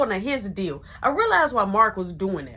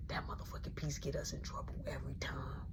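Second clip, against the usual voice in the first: breathy hushing whisper so mama stays asleep; urgent whisper; 8 semitones lower, 18 dB quieter than usual. Retold or imitated. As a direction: shush him in a tight whisper. Sneaking past the creaky floor.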